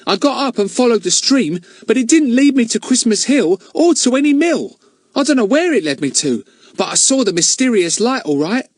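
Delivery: put-on high, quick boy's voice; Ern voice. Ern tells the children what he saw, high and eager. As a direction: rattle it off like a keen lad.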